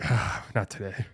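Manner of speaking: low, slow and soft, putting it off; reluctant brush-off. Retold or imitated. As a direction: drag it out like you can't face it. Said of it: "'cause it's like".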